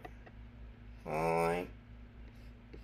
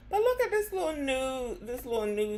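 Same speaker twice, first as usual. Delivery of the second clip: excited hype; 9 semitones higher than usual. Real, not acted. Drag host hyping a new clip.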